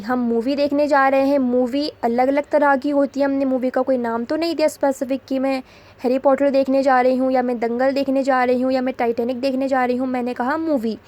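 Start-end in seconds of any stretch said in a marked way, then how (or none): none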